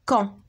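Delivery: "quand" said as kɑ̃ with no d at the end; silent final d. Shown as a demonstration. Teacher showing pupils that the d in "quand" stays silent. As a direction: say "quand" with a nasal vowel and no d sound. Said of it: "D ne se prononce pas".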